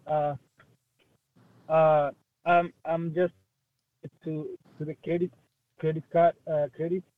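speaking up. Real, not acted. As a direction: raise the voice to carry. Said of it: "Just try to speak up".

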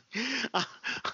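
a quick high squeal of laughter. Real, not acted. A podcast host cracking up at the blind man joke, high laugh.

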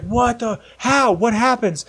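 high, startled questions, pitch jumping up; a friend hooked and desperate to know; shocked disbelief. Retold or imitated. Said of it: "He's like".

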